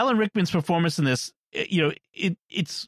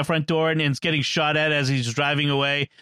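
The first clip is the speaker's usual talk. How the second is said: usual speaking voice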